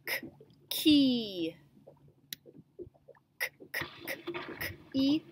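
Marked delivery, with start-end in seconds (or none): 3.4-5.2 K, k, k clicked out breathy without voice; isolated letter sound. Shown as a demonstration. Teacher to class, tapping out the letter sound. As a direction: repeat the k sound unvoiced. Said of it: "hear the first sound a little bit more".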